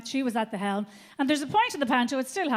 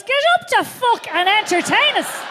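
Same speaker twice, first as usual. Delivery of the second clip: loud demanding; 7 semitones higher, 5 dB louder than usual. Retold or imitated. Parents' attitude voiced.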